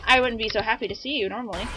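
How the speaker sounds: normal talking voice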